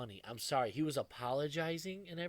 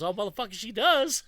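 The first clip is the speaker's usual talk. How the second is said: retold punchline; 7 semitones higher, 9 dB louder than usual. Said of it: "He goes".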